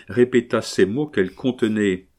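usual speaking voice

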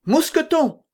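high, loud call to a servant. A narrator's reading. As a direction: shout the name to summon him. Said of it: "cria d'Artagnan".

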